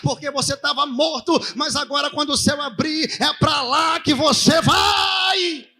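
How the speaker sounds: ringing sermon building to a drawn-out shout; impassioned preaching. Real, not acted. Preacher proclaiming forgiveness to the congregation, building fervour.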